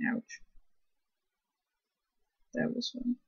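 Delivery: soft, under-breath ouch; quiet at night. Real, not acted. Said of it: "I'm trying to be really quiet".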